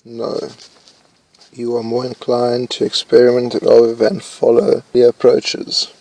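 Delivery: normal talking voice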